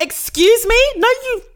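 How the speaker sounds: high, loud, laughing disbelief; stunned disbelief. Retold or imitated. [name] says she knows her, she answers in high, loud, laughing disbelief. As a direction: protest in giddy shock.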